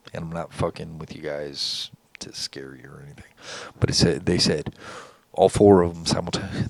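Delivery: ordinary talk, nothing marked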